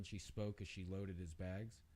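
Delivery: ordinary delivery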